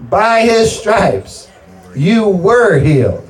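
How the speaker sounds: high, very drawn-out proclamation; emphatic preaching. Real, not acted. Preacher assuring congregation they were healed, emphatic preaching. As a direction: stretch each word and lean on "were".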